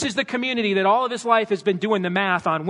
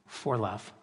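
much lower and softer; softer, tender "for love"; hushed refrain; 9 semitones lower, 11 dB quieter than usual. Real, not acted. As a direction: drop it to an intimate hush.

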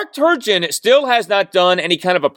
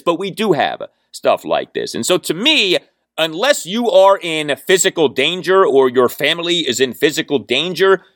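ordinary talk, nothing marked

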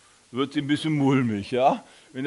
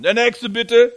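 higher, louder call to the line; 7 semitones higher, 9 dB louder, slower than usual. Retold or imitated. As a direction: call the next one forward. Attendant calls next jumper. (lauter Aufruf an die Wartenden)

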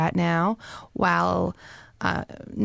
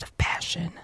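whispering; 2 semitones lower, 6 dB quieter than usual. Acted. Whispered plot detail.

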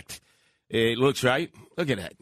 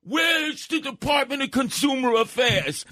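much higher, slower hammed-up outrage; mock screaming; 12 semitones higher than usual. Customer at the pizzeria screaming for a refund, hammed up. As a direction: bellow the complaint like a furious customer. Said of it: "So now all of a sudden I'm screaming".

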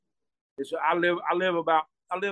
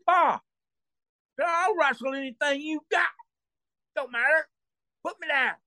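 put-on drunken bravado shout; 10 semitones higher than usual.

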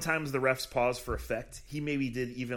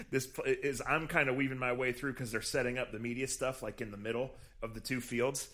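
ordinary delivery

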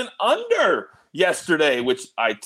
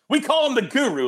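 usual speaking voice

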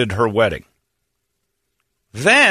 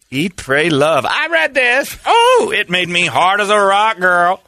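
sassy clerk voice; 5 semitones higher, slower than usual. Acted. Acting sassy bookstore clerk.

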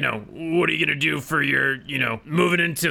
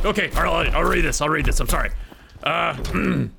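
normal talking voice

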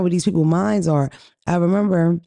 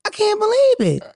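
very high, loud incredulous squeal; shrieking disbelief; 3 dB louder than usual. Real, not acted. Mocking her married cousin with a very high, loud squeal. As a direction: screech it, totally scandalized.

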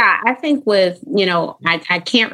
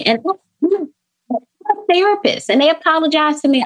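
much higher, faster, laughing incredulity; amused disbelief; 10 semitones higher than usual. Real, not acted. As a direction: say it like you can't believe it, grinning.

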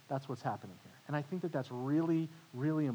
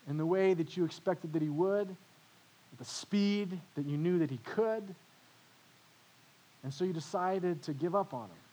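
ordinary talk, nothing marked

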